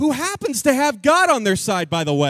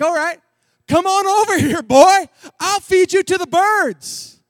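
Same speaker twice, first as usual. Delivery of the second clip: loud, higher menacing giant's taunt; shouted threats; 7 semitones higher, 7 dB louder than usual. Acted. Goliath shouts threats at young David, loud and menacing. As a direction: bellow at the boy like a giant. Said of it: "Goliath begins to shout threats".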